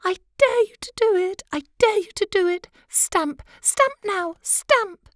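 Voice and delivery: shrill-pitched goading at her husband; wife crying out. Acted. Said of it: "who was crying".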